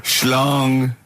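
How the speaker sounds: the word Schlong intoned like a news chime; mock news bong. Host marking each headline with a spoken bong. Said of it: "I do a bong".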